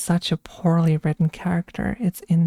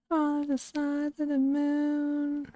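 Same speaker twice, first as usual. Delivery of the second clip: much higher, softer and slow, airy and haunting; eerie high voice; 9 semitones higher, 6 dB quieter, slower than usual. Pretend. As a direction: float it like a ghostly trailer line. Misquoting Bane line dreamily.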